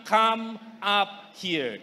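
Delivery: slow, drawn-out come up here; savored quote. Shown as a demonstration. Preacher savoring God's invitation, slow and drawn out. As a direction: linger on every word of come up here. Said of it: "Gustong-gusto ko yung salitang".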